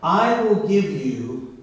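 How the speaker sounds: very slow, every phrase spaced out; deliberate, drawn-out reading of the promise; slow scripture reading. Acted. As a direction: lay each word down with long pauses. Reads key verse slowly.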